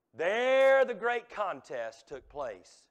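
loud, slow, drawn-out proclamation; dramatic proclamation. Real, not acted. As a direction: stretch the first word out at full voice. Dramatic climax of the sermon story.

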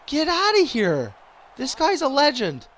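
high, stretched-out exclamation; real high-pitched amazement; incredulous outburst. Real, not acted. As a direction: cry out in disbelief at a rival's play. Exclaiming at opponent's play in game.

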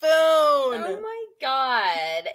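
put-on high, drawn-out Valley Girl gush; high, slow Valley Girl shriek. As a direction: stretch it out high like a mall girl. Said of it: "in Valley Girl talk".

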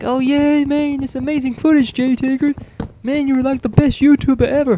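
high, loud fanboy gushing; excited fan voice. Retold or imitated. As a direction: gush like an overexcited fan. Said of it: "Everybody will be like".

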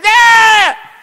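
high, loud imitated toddler shriek; child's scream. Preacher imitating a screaming little child, loud shriek. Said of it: "they cry".

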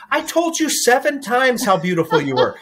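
high, laughing mock protest from the son; retelling a kid's whine while laughing; laughing imitation. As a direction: laugh through the son's indignant complaint. Son complains, mom laughing retelling.

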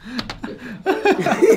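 high burst of laughter; laughing. Real, not acted. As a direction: laugh out loud at him. Laughing at friend's answer.

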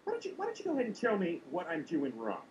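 ordinary talk

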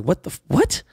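high-pitched, baffled double take; incredulous. Retold or imitated. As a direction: act out being stunned. Retold shocked reaction to news.

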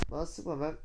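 usual speaking voice

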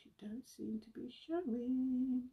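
slow whisper to the screen; hushed, drawn-out pleading; whispered coaxing. Real, not acted. Vlogger pleading with her list, slow whisper.

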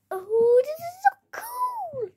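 high excited squeal; thrilled. Acted. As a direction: gush over the new toy. Excited about the toy car.

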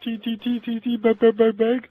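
high, silly Kermit-like puppet voice; goofy frog puppet talking. Pretend. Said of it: "This guy has to be like Kermit".

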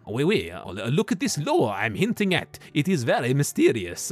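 hammed-up French accent, imitating a director. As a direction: put on your best mock French accent. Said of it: "that was my best French accent".

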